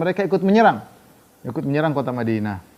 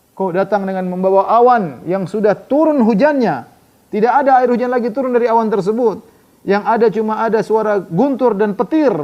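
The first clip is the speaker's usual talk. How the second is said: scornful retort; 6 semitones higher, 5 dB louder than usual. Retold or imitated. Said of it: "Ka'ab scorning Huyai's boast".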